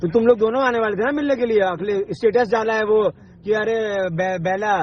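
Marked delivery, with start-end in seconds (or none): none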